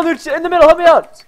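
high, loud plea to a teammate; urgent call. Real, not acted. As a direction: shout for backup mid-fight. Calls teammate for help.

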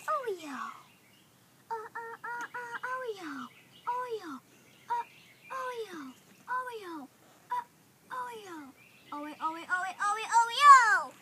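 very quiet, repeated coaxing call; hushed little falling calls; softly calling pet. Real, not acted. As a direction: coo the name under your breath. Coaxing the hen Oreo.

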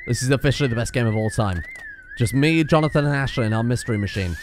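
usual speaking voice

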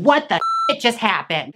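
played-up viewer's astonishment; stunned outburst. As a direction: blurt it in aghast disbelief. Retold shock at throat slicing.

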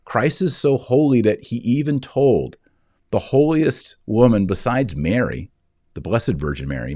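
ordinary delivery

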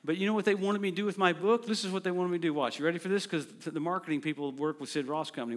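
normal talking voice

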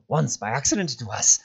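whispery protest rising to a cry; agitated creature, half whispered; panicked creature. A narrator's reading. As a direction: hiss excuses, then cry out in refusal. Gollum panicking about going back.

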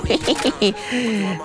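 quiet little snickering laugh; hushed run of giggles; soft giggle. Real, not acted. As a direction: let a soft giggle tail away. Taunting a fleeing opponent.

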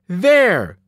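sudden loud cry, pointing someone out; a shout of alarm across the room; woman screaming. A narrator's reading. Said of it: "a woman screamed".

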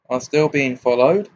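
usual speaking voice

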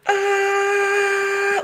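long, loud, high-pitched scream; mock death scream. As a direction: scream as loud as you can like a bad actor dying. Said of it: "as loud as he can, like, just screaming".

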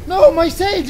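dismayed. Real, not acted. Burning his sage.

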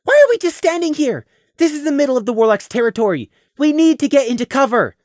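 put-on high, tense young voice; Prey's voice, urgent. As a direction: urge them to hide now.

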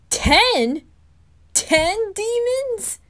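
high, loud burst then drawn-out high cry; shocked disbelief. Acted. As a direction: gasp it out in astonishment. Shocked at the answer.

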